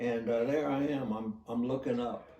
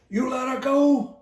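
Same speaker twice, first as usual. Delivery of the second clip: retold outraged yell, much higher and louder; angry shout; 13 dB louder than usual. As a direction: cut in shouting in disbelief. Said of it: "immediately interrupted me and says".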